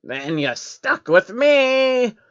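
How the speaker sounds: high, very slow smug creature voice; smug changeling. A narrator's reading. The changeling tells Jacob he is stuck with it, smug. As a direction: let the bad news sink in smugly.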